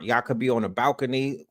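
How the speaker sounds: usual speaking voice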